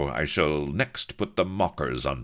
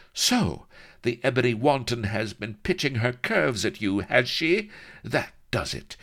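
jealous lady; 11 semitones higher, 4 dB louder than usual. A narrator's reading. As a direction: rage up high, then flatly announce you are coming. Jealous Vanity laying down the law.

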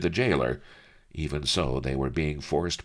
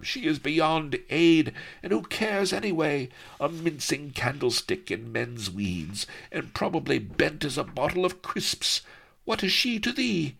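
scornful; 11 semitones higher than usual. A narrator's reading. Sheba scorns Melliflua.

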